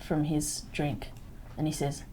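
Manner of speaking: ordinary talk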